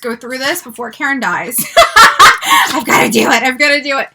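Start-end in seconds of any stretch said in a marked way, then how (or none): 1.6-2.7 high burst of laughter; loud laugh. Real, not acted. Laughs at Karen.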